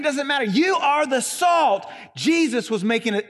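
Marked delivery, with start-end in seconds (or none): 0.5-1.9 high, slow, emphatic "You are the salt"; preacher's climax, each word of "You are the salt" stressed; emphatic declaration. Retold or imitated. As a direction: proclaim "You" high and drawn out. Emphatic climax voicing Jesus' words.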